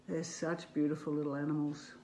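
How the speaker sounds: ordinary delivery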